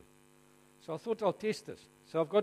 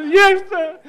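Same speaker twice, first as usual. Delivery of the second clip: much higher, louder eager yes sir; a pupil snapping to answer, much higher and louder; 10 semitones higher, 16 dB louder than usual. Retold or imitated. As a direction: shoot up like the keenest kid in class. Imitating eager know-it-all Mark.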